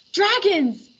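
high scared cry; startled gulp. A narrator's reading. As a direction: jump back in fright. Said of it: "he gulped".

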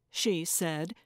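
ordinary delivery, nothing marked

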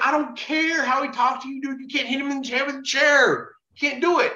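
high-pitched, insistent protest; retold exasperated scolding. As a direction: argue him down in a high, exasperated voice. Retold argument stopping a chair attack.

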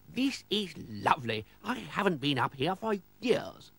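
high, savouring aristocrat voice; pleased lord. Acted. Lord back on his hilltop after years, high pleased voice.

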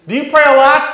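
very high, loud squawking woman's voice; mock shrill old lady. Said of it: "she's like".

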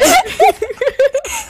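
high burst of laughter. Real, not acted. Laughs at Southern voice.

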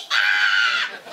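loud burst of laughing shriek. Real, not acted. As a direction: let out a laughing yelp. Laughing shout.

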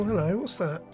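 ordinary talk

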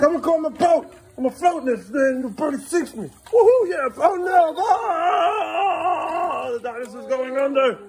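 very high squeaky dinosaur voice; toy dinosaur voice. Acted. Dad voicing a toy dinosaur for his son, high squeaky. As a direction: play the little dinosaur sinking. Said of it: "He's like".

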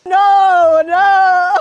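loud protest. Real, not acted. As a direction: refuse loudly, half laughing. Refusing to roll on floor.

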